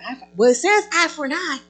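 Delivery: mocking legalist. Preacher mimics the legalistic reading.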